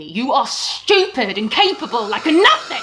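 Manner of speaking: husband yelling insults at his wife. Acted. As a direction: bellow it down at her. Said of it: "He was just yelling at me".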